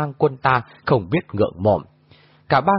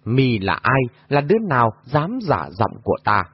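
plain reading voice

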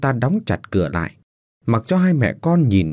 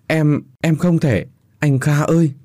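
pleading wife; 6 semitones higher than usual. Acted. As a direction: beg him through tears. Wife pleading, refusing husband. (giọng vợ van xin nghẹn ngào)

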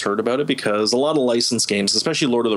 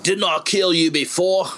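slow, higher-pitched orc taunt; accented orc voice; 7 semitones higher, slower than usual. Acted. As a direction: play a menacing orc, accent and all. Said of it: "complete with the accent and everything".

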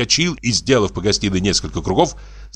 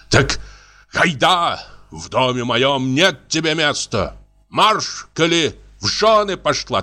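angry; 7 semitones higher than usual. A narrator's reading. Husband rants at wife.

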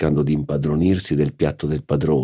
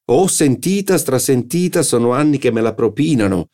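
bored scoff; 8 semitones higher than usual. Acted. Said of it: "disse il signor Verdurin".

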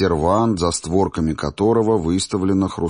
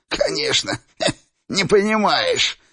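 high cackling giggle; giggling. Acted. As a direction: giggle through the words like an old man. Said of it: "старик захихикал".

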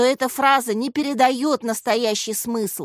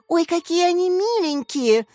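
high, gushing delight; pitched-up girlish admiration; admiring; 8 semitones higher than usual. A narrator's reading. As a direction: coo over something cute. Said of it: "восхитилась Яся".

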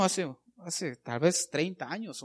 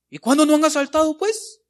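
loud, high and fast rebuttal; indignant retort; 11 semitones higher, 10 dB louder than usual. Real, not acted. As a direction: fire back at the excuse. Preacher retorts to the excuse. (réplica indignada, fuerte y rápida)

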